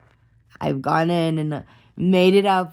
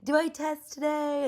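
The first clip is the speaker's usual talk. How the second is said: high, thin pleading voice; begging; 11 semitones higher, 5 dB quieter than usual. Retold or imitated. Asking the probation officer about testing, high begging plea. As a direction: beg up high like a little kid. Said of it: "I was like".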